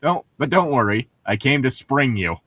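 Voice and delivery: ordinary talk, nothing marked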